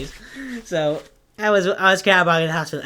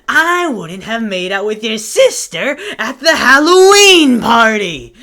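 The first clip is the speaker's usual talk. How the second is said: louder, higher, rising heated rant with a laugh; heated comeback; 5 semitones higher, 8 dB louder than usual. Retold or imitated. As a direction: fire back, escalating into a laughing confession. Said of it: "And then I was like".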